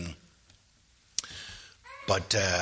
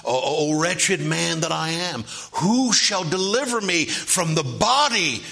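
anguished cry, pitched high and preached; Paul; 7 semitones higher, 5 dB louder than usual. Acted. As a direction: cry it out like a plea for deliverance. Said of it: "when he cried out".

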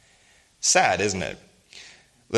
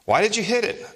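a doctor's raised questioning voice, higher; doctor asking; 11 semitones higher, 3 dB louder than usual. Retold or imitated. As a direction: play the curious doctor, pitched up.

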